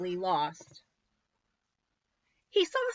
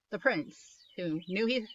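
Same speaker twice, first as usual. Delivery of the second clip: ordinary delivery, nothing marked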